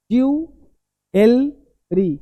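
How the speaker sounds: "ज्वेलरी" very slowly as JOO... EL... REE; long gaps inside "ज्वेलरी", part by part; broken-up slow demo. Shown as a demonstration. Teacher reads out what is written, broken-up slow demo. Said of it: "देखा क्या लिखा?".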